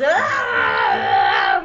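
long falling-man scream. Retold or imitated. Rex thrown off the wall, screaming all the way down. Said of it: "Rex just screams".

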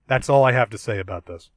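normal talking voice

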